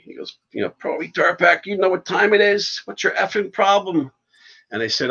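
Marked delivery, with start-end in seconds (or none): 0.7-4.1 retold scream. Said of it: "screaming at me".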